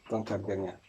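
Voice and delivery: ordinary delivery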